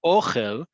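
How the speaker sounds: "ochel" stressed on the O, OH-khel; first-syllable stress. Shown as a demonstration. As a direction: hit the first syllable of "ochel", ending on a rise. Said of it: "emphasis is on the first syllable".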